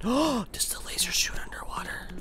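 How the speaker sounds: whispered, very soft question; gasp, then whisper it; hushed wonder. Real, not acted. Testing weapon after a gasp.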